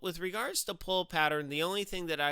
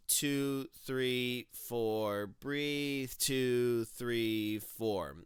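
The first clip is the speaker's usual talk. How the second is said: slow count, each number falling lower; counting the stroke rhythm; 3 semitones lower, much slower than usual. Shown as a demonstration. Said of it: "So you wanna get your head up".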